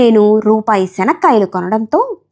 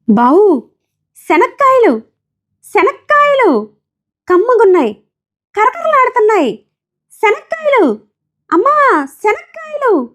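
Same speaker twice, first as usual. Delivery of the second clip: peanut seller's cry; 12 semitones higher than usual. Acted. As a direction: sing out the peanuts to every bench. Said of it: "ఆమె అరుస్తోంది". (బఠానీలు అమ్మేవాళ్ళ పిలుపులా రాగంగా అరుపు)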